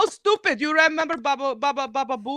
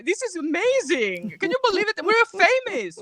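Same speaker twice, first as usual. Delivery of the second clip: mock excitement; 8 semitones higher than usual. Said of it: "he gets all excited".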